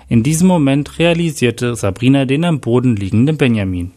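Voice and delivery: usual speaking voice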